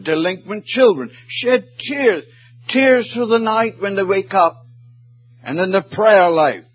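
high ringing preacher's climax; fervent pulpit cry; impassioned preaching. Acted. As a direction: soar up and drive it home. Preaching climax on mothers' tears.

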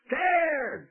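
scared. Acted. Shepherds huddled in fear.

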